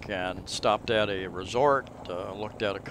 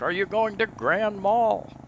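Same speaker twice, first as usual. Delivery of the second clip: calling out; 11 semitones higher, 4 dB louder than usual. Retold or imitated. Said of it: "We kinda had to call out to this person".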